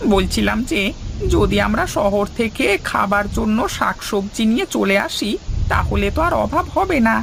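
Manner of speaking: put-on high, boyish village lad speaking up; a timid boy offering an idea to the headman; young villager voice. (উঁচু গলায় কিশোর গ্রামবাসী)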